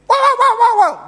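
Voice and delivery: high, loud yapping imitation; chihuahua barking. Imitating a chihuahua that spots a passing lizard, high yapping bark. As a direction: yap rapidly like a tiny frantic dog. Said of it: "the chihuahua is going to make such a big".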